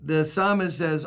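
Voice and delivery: ordinary delivery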